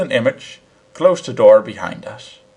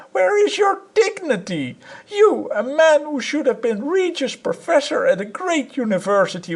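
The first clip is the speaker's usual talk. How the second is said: much higher woman's voice, scolding; a high, scornful scolding wife; angry wife. A narrator's reading. Wife to her husband, a high scornful tirade.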